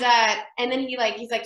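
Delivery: ordinary talk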